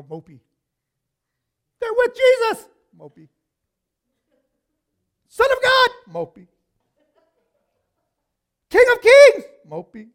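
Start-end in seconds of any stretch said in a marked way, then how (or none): 1.8-2.7 very high, loud shout; exclaimed acclaim. Acted. Shouted acclaim against mopey disciples. 5.3-5.9 very high, loud shout; exclaimed acclaim. Acted. Shouted acclaim against mopey disciples. 8.7-9.4 very high, loud shout; exclaimed acclaim. Acted. Shouted acclaim against mopey disciples.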